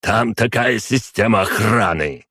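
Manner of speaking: heated, forceful outburst; angry bark. A narrator's reading. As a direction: throw the excuse back in fury. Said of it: "рявкнул Шпеер".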